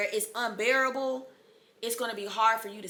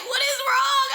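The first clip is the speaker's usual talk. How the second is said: alarmed question; 7 dB louder than usual. Retold or imitated. Staff asking alarmed.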